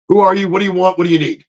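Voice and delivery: imitated snappy questions; sour assistant. Assistant cutting him off, mock curt. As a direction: rattle off the curt questions in her voice. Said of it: "I wasn't even able to get".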